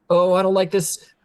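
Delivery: worried fussy doctor; nervous doctor. Acted. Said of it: "the doctor's like".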